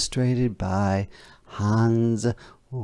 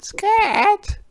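very high, loud hen squawk; piercing storybook chicken; 6 dB louder than usual. A narrator's reading. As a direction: shoo it away in a falsetto hen voice. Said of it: "the hen said".

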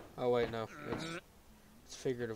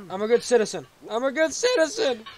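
loud, higher-pitched insistence; shouting; 7 semitones higher, 6 dB louder than usual. Real, not acted. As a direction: shout it pleadingly, pushing higher the second time. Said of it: "I'm just shouting".

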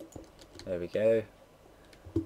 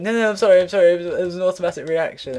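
higher, louder flustered mock apology; flustered girl apologizing; 9 semitones higher, 11 dB louder, faster than usual. Girl apologizing to the old man, flustered higher voice. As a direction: gush out an embarrassed apology. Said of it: "she was like".

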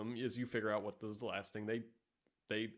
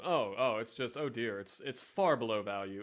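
ordinary talk

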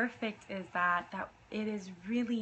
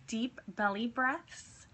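ordinary delivery

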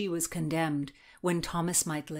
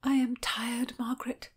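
higher, weary whisper; 6 semitones higher than usual. A narrator's reading. Anne tells Margaret she is tired, whispering. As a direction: whisper it, worn out. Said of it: "Anne whispered".

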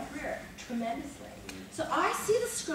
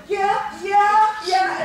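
much higher, much louder repeated "yeah"; loud taunting yeahs; 8 semitones higher, 14 dB louder, much slower than usual. Retold or imitated. Spike taunting backstage, loud repeated yeah. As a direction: goad him on. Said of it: "and he's going".

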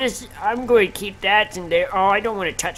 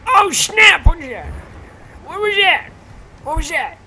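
high, alarmed yelp easing off; startled outburst; 9 semitones higher, 5 dB louder than usual. Real, not acted. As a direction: jump in shock and demand to know. Startled by something in the game.

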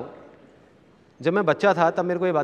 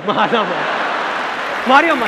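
rising, much higher and louder pleas; frantic bystander yelling; 4 semitones higher, 4 dB louder, slower than usual. Retold or imitated. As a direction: desperately shout to stop the fight.

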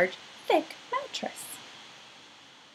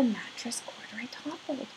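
hushed whisper, almost no voice; whispered aside; 12 dB quieter than usual. A narrator's reading. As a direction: lean in and whisper it like a secret. Bear falls off the bed.

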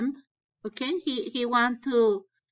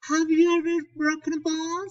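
yelled question; 6 semitones higher, 3 dB louder, faster than usual. Acted. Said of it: "yelled, grita".

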